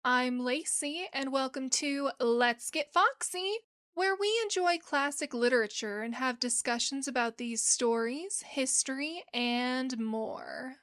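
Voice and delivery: hushed radio-style welcome; podcast intro read. Acted.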